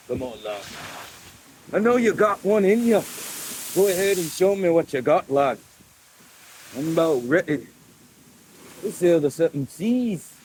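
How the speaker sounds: lightly put-on Scottish sailor; Scottish accent. Egging on the sea in a light mock Scottish accent. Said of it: "Scottish isn't really exactly a hard accent to do".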